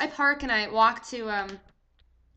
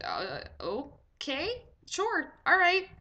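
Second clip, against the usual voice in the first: slow, halting okay, then pitched up; hesitant agreement; 5 semitones higher, much slower than usual. Retold or imitated. Patient to nurse after surprise test request, hesitant. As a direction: go along with it uncertainly.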